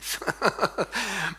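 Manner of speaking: chuckling laugh. Real, not acted. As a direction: laugh at own joke. Laughs at own joke.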